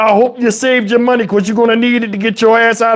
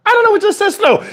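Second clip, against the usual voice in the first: high mock voice; 10 semitones higher, much faster than usual. Mock verse voice again.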